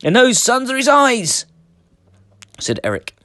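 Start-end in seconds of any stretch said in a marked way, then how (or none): 0.0-1.5 frightened cry. A narrator's reading. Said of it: "they cried".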